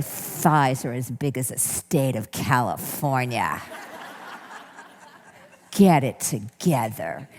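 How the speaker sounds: low, cold, scolding critic; harsh inner critic voice. Shown as a demonstration. Speaker showing the workshop how the critic talks, low and scolding. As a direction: sneer it down at yourself like a judge. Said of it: "This is how that voice talks".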